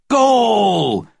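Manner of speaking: loud high commentator yell; goal shout. A narrator's reading.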